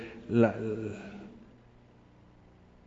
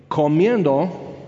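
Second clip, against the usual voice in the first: drawn-out example word; much slower than usual. Shown as a demonstration. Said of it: "Entonces, comiendo".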